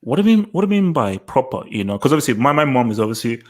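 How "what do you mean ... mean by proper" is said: incredulous. Retold or imitated. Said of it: "and I said".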